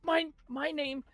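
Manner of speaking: high, loud stammering creature voice; stuttering high Kenku greeting; nervous bartender voice. Acted. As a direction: squeak it out like a jittery bird-man. Kenku introduces himself and brothers.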